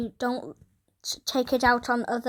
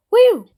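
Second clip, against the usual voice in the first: loud high whoop; loud excited cowboy whoop; 11 semitones higher, 12 dB louder than usual. Pretend. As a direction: whoop like a rodeo rider. Cowboy whoop in Wild West game.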